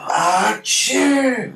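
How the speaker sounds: high mock sneeze, burst out; hammed-up big sneeze. Imitating coworker's manly sneeze.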